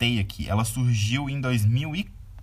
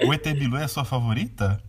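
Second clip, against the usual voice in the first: usual speaking voice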